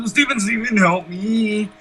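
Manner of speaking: high pleading call to a teammate; calling for help. Real, not acted. Gamer begging his teammate Steven for help, high plea.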